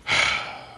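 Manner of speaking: long voiceless sigh; imitated sigh. Pacey reacting to Joey's confession with a sigh. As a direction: let out an acted, weary breath. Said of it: "Pacey sighs".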